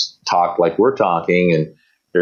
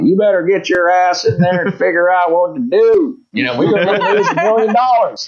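put-on Texas accent, high-pitched urgent order; mock a Texan boss laying down the law, high; imitated Texas bosses; 11 semitones higher than usual. Texan bosses ordering a coach to fix it, high Texas accent. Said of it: "very Texas".

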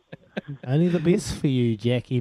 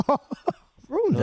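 high, disbelieving echo of the jab; surprised; 12 semitones higher than usual. Real, not acted. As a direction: play it as mock offence, pitch shooting up. Mock-offended at a cheeky jab.

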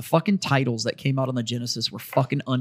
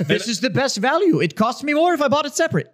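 mock Yiddish dad voice; Yiddish accent; 6 semitones higher than usual. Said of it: "I love how my dad has an accent now".

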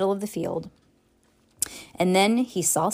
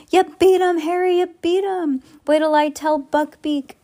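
much higher, louder joyful shout; overjoyed Hagrid; 9 semitones higher, 4 dB louder than usual. A narrator's reading. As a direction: bellow it out with delight. Hagrid celebrating Harry's win.

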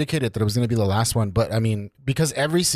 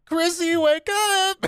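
much higher, calling out a name; much slower than usual. Retold or imitated. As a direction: call her like in the show. Imitating show scene, calling.

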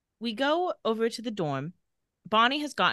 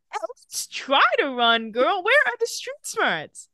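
higher, drawn-out mock plea; exasperated urging; 9 semitones higher, 4 dB louder, slower than usual. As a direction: playfully urge someone foolish to flee. Urging a story victim to flee.

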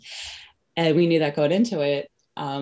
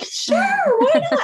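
retold agreement that jumps much higher and louder; eager excited yes; 8 dB louder than usual. To the midwife, agreeing eagerly, high excited squeal. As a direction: squeal it like you can't believe your luck.

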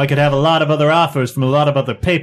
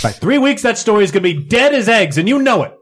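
high, loud, heated protest; heated argument; 7 semitones higher, 3 dB louder than usual. Acted. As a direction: push back hard at the boss. Reporter argues with the chief.

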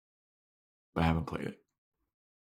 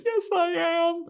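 much higher, mock protest; squeaky Dawn voice. Podcaster as Dawn insisting she is human, high squeak. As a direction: pitch way up, indignant sister.

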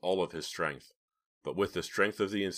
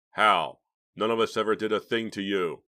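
loud, indignant challenge; angry accusation; 3 semitones higher, 7 dB louder than usual. A narrator's reading. Alex confronting Philip, loud and indignant. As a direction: throw it back at him.

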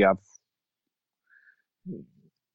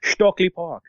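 higher-pitched mock Dutch-German accent; comic Dutch-German put-on voice; 9 semitones higher, slower than usual. Shown as a demonstration. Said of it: "we'll go Dutch German".

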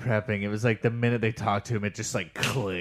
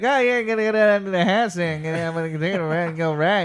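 raised, loud slurred Pittsburgh babble; hammed-up, hard-to-follow Pittsburgh accent; mock Pittsburgh accent; 7 semitones higher, 6 dB louder than usual. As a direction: run the words together in exaggerated Pittsburgh speech. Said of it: "Those motherfuckers are hard to understand".

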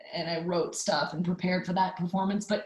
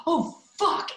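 startled curse; 11 semitones higher, 4 dB louder than usual. Retold or imitated. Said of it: "I was like".

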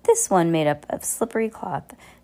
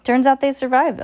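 higher, louder gloat; gloating villain; 7 semitones higher, 6 dB louder than usual. A narrator's reading. Olaf gloating to Esme, higher and louder. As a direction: crow it smugly. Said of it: "Olaf said".

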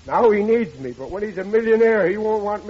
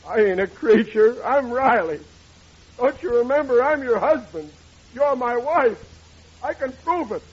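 higher and louder, insistent appeal; desperate pleading; 7 semitones higher, 5 dB louder than usual. Acted. Forgotten husband pleading with his wife to recognize him, higher and urgent. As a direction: make her remember you.

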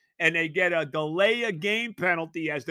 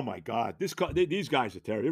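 normal talking voice

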